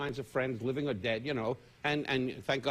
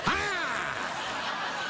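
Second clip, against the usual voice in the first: mock gasp; 9 semitones higher than usual. Mock shock at crude question.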